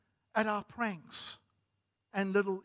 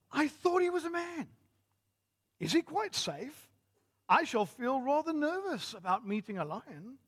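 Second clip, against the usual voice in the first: high-pitched posh English voice; Susan; 6 semitones higher than usual. A narrator's reading. Susan worries about meeting a lion, posh English. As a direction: put on a posh English girl, pitched well up. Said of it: "in a posh English voice".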